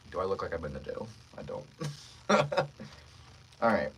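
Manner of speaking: normal talking voice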